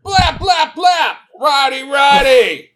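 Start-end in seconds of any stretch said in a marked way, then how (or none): 0.0-1.1 high shouted blap noises; mock gunshots. Host firing mock shots after the burn. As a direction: fire imaginary shots with the mouth.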